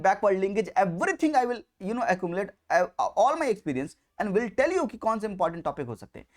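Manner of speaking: ordinary delivery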